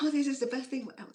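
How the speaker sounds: quick, high mimicry of a delighted eater; imitated gushing praise. Acting out sweetheart's delighted praise.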